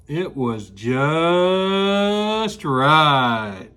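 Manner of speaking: very slow, low and contented; drawn-out satisfied. Acted. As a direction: stretch it out like pure bliss. Goldilocks finds the perfect chair.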